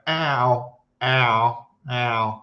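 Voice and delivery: one-syllable cat yowl, no M; short run of imitated cat "Ow"s; ordinary cat cry. Shown as a demonstration. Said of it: "most cats go".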